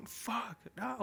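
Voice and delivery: high-pitched frustrated outburst; mock wail of angry refusal; retold anger. Boyfriend told to stop punching, retold high angry frustration. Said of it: "I was so fucking angry".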